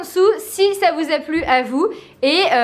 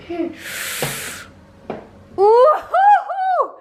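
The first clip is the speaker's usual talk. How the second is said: excited; 12 semitones higher, much slower than usual. Real, not acted. Tense filling, high ouh.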